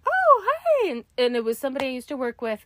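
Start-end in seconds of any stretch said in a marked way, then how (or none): 0.1-0.9 high, bright surprised hi; mock chirpy hello; surprised greeting. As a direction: caught off guard by an old coworker. Greets former coworker in pool.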